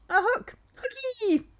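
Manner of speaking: very high, loud excited cry; giddy high-pitched glee; delighted squeal. Real, not acted. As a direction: squeal with joy at a find. Delighted at finding a hook.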